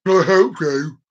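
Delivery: low-voiced mock shop assistant; put-on voice of a dopey till worker; till lad impression. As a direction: imitate the bloke on the till. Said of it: "this bloke was like".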